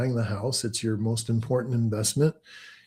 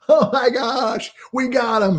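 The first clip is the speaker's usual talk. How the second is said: giddy prank caller; 11 semitones higher, 3 dB louder than usual. Retold or imitated. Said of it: "be like".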